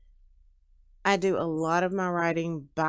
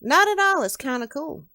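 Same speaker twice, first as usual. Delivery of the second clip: high, loud start easing down; enthusiastic, high-pitched young man; upbeat reassurance; 9 semitones higher, 7 dB louder than usual. Acted. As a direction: jump in eagerly to wave off the apology.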